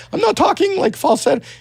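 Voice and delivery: his cartoon character voice, high and bright. Shown as a demonstration. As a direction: talk in the little cartoon kid voice. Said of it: "that's not my voice".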